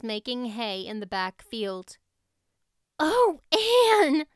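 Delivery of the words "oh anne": high, loud gasping cry of relief; a sudden high, loud gasp of a name. A narrator's reading. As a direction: burst out breathless with relief. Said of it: "gasped Diana".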